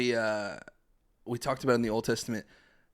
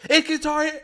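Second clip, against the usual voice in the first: a little brother blurting it out, much higher and louder; 7 dB louder, faster than usual. Retold or imitated. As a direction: spoil the surprise, high and loud. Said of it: "Eli goes".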